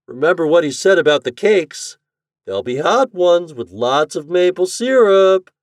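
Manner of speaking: high, loud teasing singsong. A narrator's reading. Chuck tempting Toad with breakfast cakes, teasing. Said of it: "teased Chuck".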